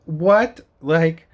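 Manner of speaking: loud, high what; incredulous what; outraged what. Real, not acted. Outraged at the rule.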